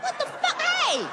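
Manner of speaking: shocked high-pitched what the fuck; startled yelp. Retold or imitated. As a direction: jump out of your skin. Startled reaction to wife's boo.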